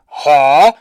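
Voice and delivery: loud, dopey grunt; blunt, baffled soldier voice; mock soldier. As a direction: play a slow-witted guard caught puzzled. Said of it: "And he's like".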